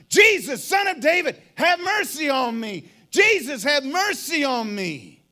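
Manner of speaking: loud, high, pleading shout; crying out. Acted. Blind beggar calls to Jesus passing by, crying out. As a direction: shout it out over the crowd. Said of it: "he stood to his feet and he cried out".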